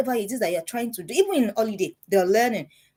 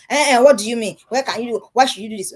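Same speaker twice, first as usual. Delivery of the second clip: louder, indignant-sounding challenge; mock parental objection; 3 semitones higher, 7 dB louder than usual. Parent challenging the teenagers' holiday plan, mock objection. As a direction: cut in loudly as a disapproving parent.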